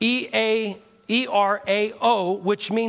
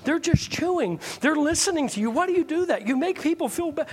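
higher-pitched mock scolding wife; lightly acted wifely telling-off; imitating upset wife; 6 semitones higher than usual. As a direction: plead and chide like an exasperated wife. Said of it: "My wife gets mad all the time".

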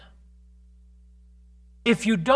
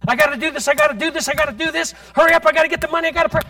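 hurried, pressured believer; 6 semitones higher, 6 dB louder, much faster than usual. Retold or imitated.

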